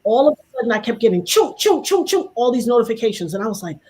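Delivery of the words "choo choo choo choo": high, rapid-fire notification noises; imitated alert chirps. Speaker mimicking endless phone notifications, high chirping noises.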